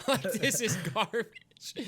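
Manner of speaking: cracking up while calling it garbage; laughing. Real, not acted. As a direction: laugh it out, high and loud. Mocking the trailer, laughing.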